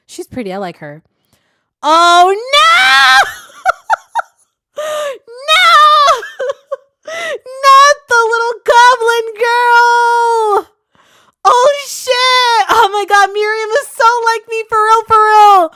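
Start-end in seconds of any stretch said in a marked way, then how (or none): 1.9-10.7 high, loud cries of real dismay; horrified shouting. Real, not acted. Reader shrieking at the screen as the goblin girl is hurt, high and loud. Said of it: "Let me stop yelling into your eardrums". 11.4-15.7 high, loud, thrilled yelling; excited shouting. Real, not acted. Reader hyped by the webtoon, yelling high and loud. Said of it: "Let me stop yelling into your eardrums".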